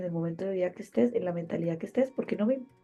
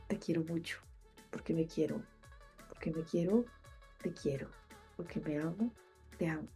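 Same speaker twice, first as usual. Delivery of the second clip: tender; 9 dB quieter than usual. Real, not acted. Tender closing words.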